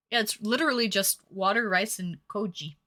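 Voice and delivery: ordinary talk, nothing marked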